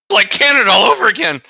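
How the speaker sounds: loud high outburst; excited shout. Real, not acted. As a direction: shout it out in mock outrage. Joking outburst.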